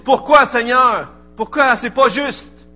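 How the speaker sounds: high, loud cry of protest at God. Retold or imitated. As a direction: cry out your complaint to the Lord, hurt and indignant. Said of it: "Puis on dit". (cri de protestation fort et aigu)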